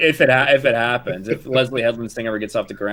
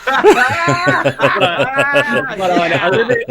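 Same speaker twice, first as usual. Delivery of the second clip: laughing loud and high; 7 semitones higher, 6 dB louder, slower than usual. Real, not acted. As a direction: laugh through the words.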